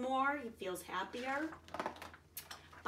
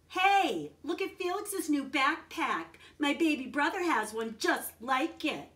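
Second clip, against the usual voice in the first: put-on mean kid; 6 semitones higher, 6 dB louder than usual. Mean kid teasing on bus.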